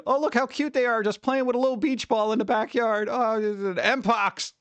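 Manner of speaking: voicing a clueless person gushing over cute squirrels; mock naive voice. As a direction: gush over it like a naive fan. Mocking clueless people again.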